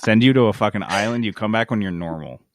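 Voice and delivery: ordinary talk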